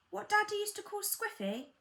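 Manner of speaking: put-on very high child's question; high-pitched child, innocently curious. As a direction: pipe up like a small child. Child interrupts parent's story with question.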